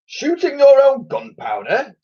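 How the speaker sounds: high, loud incredulous admiral roar; thundered. Acted. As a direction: bellow the question in disbelief. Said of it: "thundered the Admiral".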